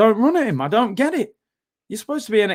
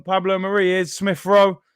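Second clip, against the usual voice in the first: ordinary talk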